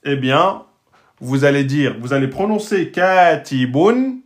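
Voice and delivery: ordinary talk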